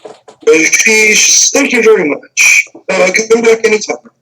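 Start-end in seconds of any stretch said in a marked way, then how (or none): 1.5-4.1 higher, quick friendly thanks; brisk shopkeeper sending customers off; cheerful shopkeeper. Acted. Diane thanks the party for their purchase, higher and quick.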